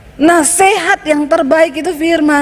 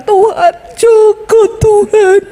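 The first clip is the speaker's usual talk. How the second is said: mock wailing prayer; 7 semitones higher, slower than usual. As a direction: ham up a desperate prayer calling out a stranger's name. Mocking prayer for another's husband. (doa meratap yang dibuat-buat, tinggi dan keras)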